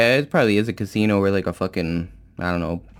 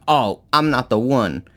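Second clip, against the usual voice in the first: higher, louder mock-indignant woman; mocking imitation of woman; 7 semitones higher, 4 dB louder than usual. Woman threatening to call the cops, mocking higher voice. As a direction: put on her self-righteous threat, pitched up. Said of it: "'Cause she's just like".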